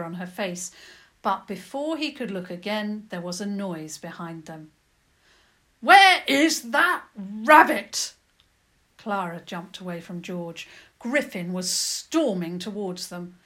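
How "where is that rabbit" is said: very loud, slow, furious demand; thunderous shout, each word stretched out; angry roar. A narrator's reading. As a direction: bellow it word by word. Griffin storms towards them angrily.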